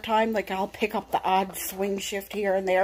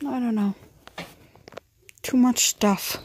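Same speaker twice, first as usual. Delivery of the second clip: ordinary talk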